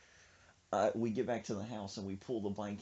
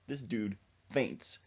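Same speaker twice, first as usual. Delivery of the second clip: ordinary talk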